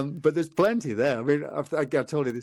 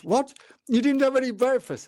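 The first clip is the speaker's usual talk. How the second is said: shocked disbelief; 10 semitones higher than usual. Retold or imitated. Shocked partner hears he skipped breakfast.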